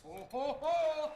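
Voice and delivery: big rising ho-ho-ho; Santa belly laugh. Pretend. Santa announces himself at the door, jolly ho-ho-ho. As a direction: boom it out as Santa.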